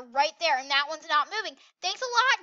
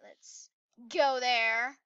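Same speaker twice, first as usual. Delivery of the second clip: normal talking voice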